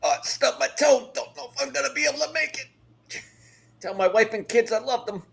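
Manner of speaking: high-pitched hammed-up dying soldier; wounded soldier gasping. Mock dying words over stubbed toe.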